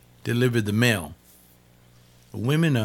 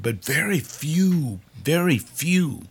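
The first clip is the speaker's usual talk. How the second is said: preacher driving the point home; emphatic; 6 semitones higher, slower than usual. Acted. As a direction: lean hard on very few.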